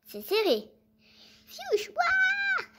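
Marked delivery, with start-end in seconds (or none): 1.5-2.6 startled cry. Acted. Fight cry.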